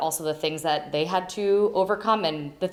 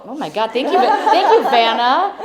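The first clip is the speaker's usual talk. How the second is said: high, loud delighted thank you; surprised thanks; 6 semitones higher, 8 dB louder than usual. Real, not acted. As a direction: caught off guard, thank her gladly. Surprised by gift handed over.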